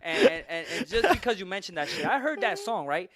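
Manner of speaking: high, laughing excitement. Real, not acted. Telling his friend about the song, laughing and excited. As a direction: laugh through it, worked up.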